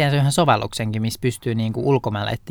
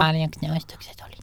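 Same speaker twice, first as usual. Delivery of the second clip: softer, higher hushed question; mock whispering; 4 semitones higher, 5 dB quieter than usual. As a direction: ask it softly, as if whispering were your only voice. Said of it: "mut sit toisaalta kuiskauksen". (leikillään hiljaa kuiskaillen)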